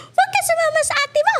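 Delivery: very high imitated scolding, breaking into laughter; mock angry parent. Daughter imitating her parents forbidding the Cebu trip, mock angry.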